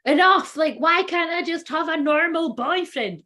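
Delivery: high, exasperated outburst; mock fed-up girlfriend, slowing; a hammed-up exasperated plea; exasperated woman. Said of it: "she's like".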